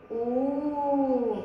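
"أو" as one very long oo; long waw madd. Shown as a demonstration. Teacher demonstrating waw madd, "أو" as a drawn-out oo. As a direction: hold the oo of "أو" slowly and evenly. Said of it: "ما هو صوت مد الواو".

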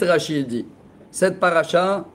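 ordinary delivery

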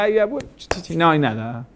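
a touch of a dismissive wave-off, lightly voiced; mock dismissive tutting; dismissive brush-off. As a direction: shrug it away as if it were nothing. Muawiyah brushing off the question.

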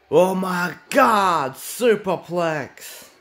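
high, stretched-out hype call, drawn out slow; excited play-by-play yell. Real, not acted. Commentator screaming at the superplex, thrilled. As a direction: let the big move blow you away. Commentator calls a huge move.

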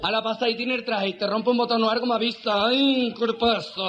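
camp army clerk. Acted. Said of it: "Ese mariquita ha puesto la máquina".

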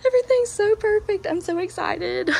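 excited squeal. Real, not acted. Gushing over finished patio.

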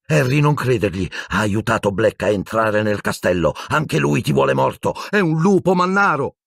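high-pitched, urgent accusation; cried warning. A narrator's reading. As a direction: build to a high, damning revelation. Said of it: "gridò Hermione".

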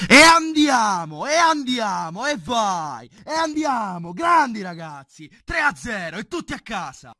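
high, loud, drawn-out cheer; celebratory cheering. Real, not acted. Celebrating the win.

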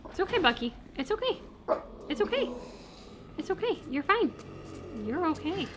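high coaxing pet voice; high sing-song reassurance to a dog. Real, not acted. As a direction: soothe a scared puppy in a high voice. Soothing the barking dog.

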